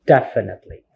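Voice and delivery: "Definitely" stressed on DEF; DEF-initely, the rest falling away; stress on first syllable. Shown as a demonstration. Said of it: "the stress here is in the first syllable".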